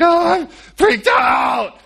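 high, slow, hammed-up panic; mock freaked out wail. Pharaoh tells his advisers his fear, mock panicked wail. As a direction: ham up a terrified king's cry. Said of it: "Pharaoh's like".

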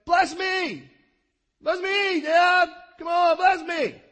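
high, loud desperate pleading cry; bitter cry. Acted. Esau crying out to his father for a blessing, loud desperate wail. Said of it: "cried with an exceeding great and bitter cry".

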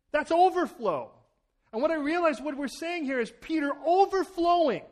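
fervent preaching. Acted. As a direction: preach it with rising fire. Preaching at full force.

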